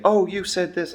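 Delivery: "Oh" jumps up high in a mock accusing whine; mock accusation. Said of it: "people will say".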